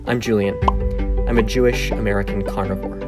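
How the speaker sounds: ordinary talk, nothing marked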